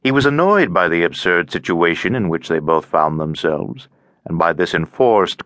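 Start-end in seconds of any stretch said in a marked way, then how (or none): none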